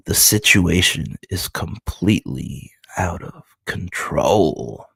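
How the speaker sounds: slow, weighty comic-book narration; dramatic narration. Acted. Reading a comic about Goro to viewers, dramatic narration. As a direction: draw the words out with menace.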